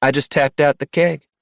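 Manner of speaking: April cutting in, high and bright-voiced; bartender April. A narrator's reading. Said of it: "April said".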